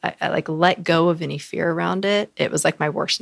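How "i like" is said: normal talking voice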